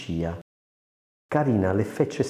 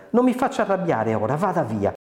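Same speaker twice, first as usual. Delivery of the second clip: high-pitched, cross narrated voice; vexed, pitched well up; irritated dismissal; 8 semitones higher than usual. A narrator's reading. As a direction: send him off in rising irritation. Carina angrily sends the man away.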